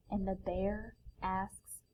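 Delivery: slow, drawn-out storybook refrain; slow it down before the big question. A narrator's reading. Refrain slowed each time.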